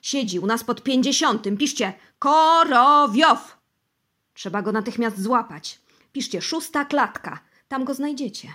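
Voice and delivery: loud, high, urgent shouting. Acted. Bosy shouts to the interrogators to catch Korowiow. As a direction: shout orders to catch him at once. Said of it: "wrzasnął Bosy".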